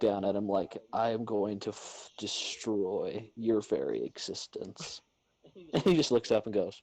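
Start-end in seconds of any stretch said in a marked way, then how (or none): 0.9-5.0 slow, quiet deliberate threat; mock menace. Owner looking down at fussy cat, quiet slow mock menace. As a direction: threaten softly, weighing each word.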